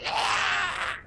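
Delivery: high-pitched creature scream; Hutt scream. Acted. Game master voices the stabbed Hutt, high scream. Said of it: "the hut starts screaming".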